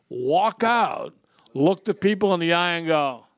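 normal talking voice

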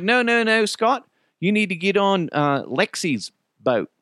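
head him off, as the local boatman; kayak rental guy. Retold or imitated. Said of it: "the guy that sold us the kayaks".

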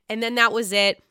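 ordinary delivery

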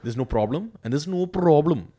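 light Indian accent, repeated phrase. Shown as a demonstration. Demonstrating the Indian accent's swing, light Indian accent. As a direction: ease the line in an Indian accent. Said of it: "the generic Indian accent".

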